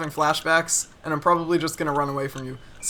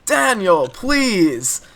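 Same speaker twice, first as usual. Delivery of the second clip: mock exasperated yell, higher and louder, falling on each word; retold angry scream; 3 semitones higher, 5 dB louder, much slower than usual. Preschool teacher fed up with Daniel's Jupiter question, imitated angry yell. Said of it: "She screamed at me".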